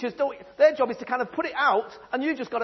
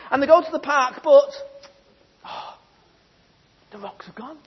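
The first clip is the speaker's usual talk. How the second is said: ordinary talk, nothing marked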